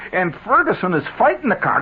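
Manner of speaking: usual speaking voice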